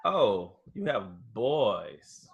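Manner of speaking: ordinary talk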